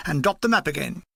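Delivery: plain reading voice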